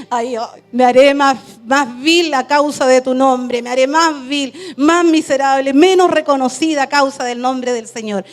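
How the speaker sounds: loud, pleading prayer cry; a loud, impassioned pledge of humility; fervent prayer. Retold or imitated. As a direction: declare it to the Lord with full force. Quoted prayer said with fervour.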